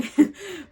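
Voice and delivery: high, brief laugh; quick real giggle mid-sentence; short laugh. Real, not acted. Reader voicing the viewer's wait for confirmation, short laugh.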